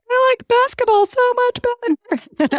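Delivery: put-on very high, whining little girl; Jay whining to mom on the ski hill; crying child's whine. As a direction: whine like a tearful kid. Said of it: "Jay is crying, going".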